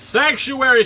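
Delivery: high mock proclamation, falling; calling out. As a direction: call it out like Quasimodo from the cathedral. Acts out Quasimodo calling sanctuary.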